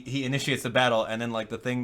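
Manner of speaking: normal talking voice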